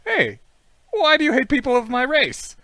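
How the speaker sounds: hammed-up, loud and high questioning; high-pitched mock challenge. Confronting someone who hates their race, high mock challenge.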